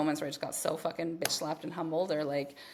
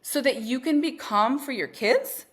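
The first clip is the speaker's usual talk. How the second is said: loud, slow, higher mock disbelief; incredulous self-scolding; 5 semitones higher, 6 dB louder, slower than usual. Mother scolding herself over meditating for her kids, incredulous. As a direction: spell out the absurdity, exasperated.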